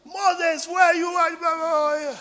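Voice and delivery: high, slow drawn-out call; voicing the friend's high call. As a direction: call him from across the room. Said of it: "then he said".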